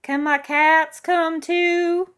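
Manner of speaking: put-on high, loud, slow old-lady voice; old woman calling. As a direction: call out a drawn-out plea. Old woman calls out a request.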